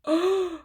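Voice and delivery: gasp, then high drawn-out "ooh"; shocked gasp. Real, not acted. Reacting to a surprise nominee, shocked gasp. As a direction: suck in air and let out a high ooh.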